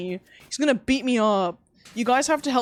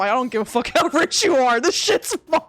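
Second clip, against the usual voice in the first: mock indignant shout; 5 semitones higher, 7 dB louder than usual. Retold fight over lamb.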